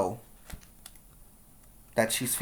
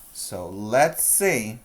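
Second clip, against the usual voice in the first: ordinary talk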